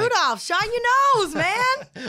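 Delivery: high, loud, drawn-out holler; exasperated yell. Retold or imitated. Rider snapping at a driver who won't use GPS, exasperated yell. Mock yelling at driver for directions.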